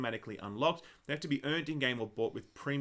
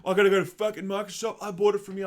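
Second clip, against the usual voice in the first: louder, higher, irate customer complaining; mock angry customer; 4 semitones higher, 5 dB louder than usual. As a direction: play the fed-up customer demanding help. Angry customer at retail counter.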